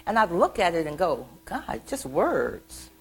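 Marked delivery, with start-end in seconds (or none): 1.4-2.9 slow, drawn-out disbelief; imitated kid's let-down; unimpressed child. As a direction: stretch the words in mock disgust. Child disappointed by the book.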